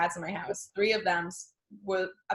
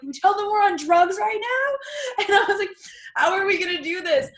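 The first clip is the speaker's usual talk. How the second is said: laughing panic; 12 semitones higher, 4 dB louder than usual. Retold or imitated. Retelling the vet call to her friend, high laughing panic. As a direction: squeal it out in mock despair.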